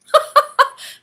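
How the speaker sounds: a sudden high burst of laughter; giggly high laugh; high giggle. Real, not acted. Laughs at her own question.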